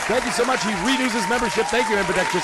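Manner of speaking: loud, high excited shout-out; real high-energy streamer hype; hype announcer. Real, not acted. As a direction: announce it like a big arrival. Announces a membership to viewers.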